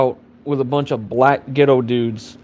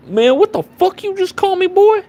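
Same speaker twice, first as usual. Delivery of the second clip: very high-pitched yelled challenge; mock outrage; 3 dB louder than usual. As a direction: act out exploding at an insult, pitch shooting way up. Acted angry reply in imagined quarrel.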